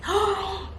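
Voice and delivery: high gasp of disbelief; sharp intake of shock. Retold or imitated. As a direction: gasp as if seeing the result. Reliving the test result.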